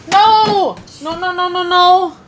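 high, loud string of refusals; urgent scolding. Real, not acted. Babysitter stopping a busy two-year-old, urgent high yell. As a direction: cry out to stop them at once.

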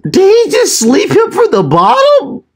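very high-pitched, incredulous question; stunned disbelief. Real, not acted. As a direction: squeal it in shocked disbelief at the knockout. Stunned reaction to a knockout.

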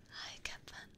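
very soft, breath-only hello; hushed little repeat of a greeting; whispered greeting. Real, not acted. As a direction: whisper it fondly. Same greeting repeated, much softer.

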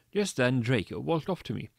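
ordinary delivery, nothing marked

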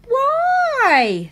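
put-on high squeaky little girl; whiny little princess voice; cartoon princess. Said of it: "said the little princess".

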